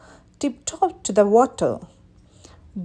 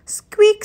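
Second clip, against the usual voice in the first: high squeaky lantern creak; 10 semitones higher, 5 dB louder than usual. A narrator's reading. Narrator voicing the swinging lantern, high squeak.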